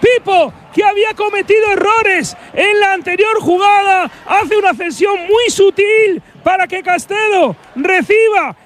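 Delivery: goal commentary. Real, not acted. Goal recap at full force.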